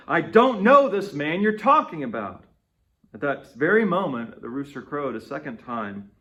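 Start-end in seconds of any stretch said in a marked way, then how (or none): 0.1-2.4 loud, higher, heated denial; cursing denial. Acted. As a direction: swear it off angrily. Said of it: "But he cursed and swore".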